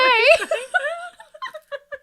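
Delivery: high loud cackle; real laughing fit; big laugh. Real, not acted. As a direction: burst out laughing hard.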